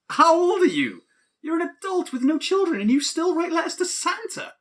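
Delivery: can't believe a grown adult still does this; incredulous disbelief. Acted. Shocked that adult writes Santa.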